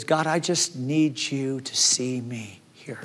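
slow whispered plea; desperate whisper. Acted. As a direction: whisper it like you're barely holding on.

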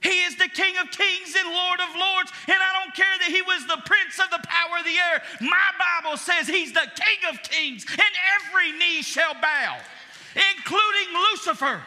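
high, loud triumphant preaching; full-force preaching. Acted. Pastor proclaiming Christ's kingship to the church, loud and high. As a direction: declare it loud over the enemy.

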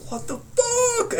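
high strained re-enacted freak-out; panicked squeal. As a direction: squeal it slowly in mock panic. Acted panic as screaming continues.

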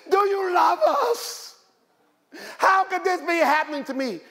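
high pleading whine; anguished questioning; doubting cry. Acted. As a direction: plead like someone losing faith. Voicing a doubting believer.

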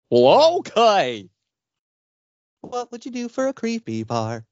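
swooping cartoon goof giving in; Goofy. Agreeing to the creep's deal, high goofy voice. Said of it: "sound like Goofy".

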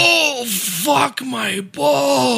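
high, drawn-out mock howl of pain; acted agony. Acting out a delayed blow to the balls, agonized. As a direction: wail it slowly as delayed pain hits.

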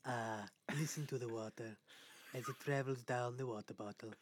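soft, hushed ASMR murmur; quiet mock ASMR narration. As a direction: tease it like a soft-spoken ASMR video. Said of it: "Some A S M R shit right here".

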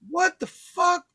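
high incredulous exclamation; stunned disbelief. Retold or imitated. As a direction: act out being dumbfounded, pitch way up. Retold shock as she runs off.

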